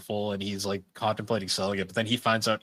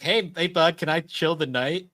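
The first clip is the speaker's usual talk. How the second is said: higher, chummy begging; mock eager friend; 7 semitones higher than usual. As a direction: sweet-talk your way in. Jinho asking to stay.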